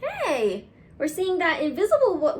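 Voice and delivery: plain reading voice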